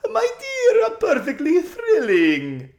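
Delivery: very high, loud, slow and laughing; thrilled spoiled socialite; gushing society lady. A narrator's reading. Lasaraline gushing.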